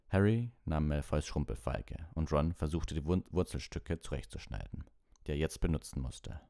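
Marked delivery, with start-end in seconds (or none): none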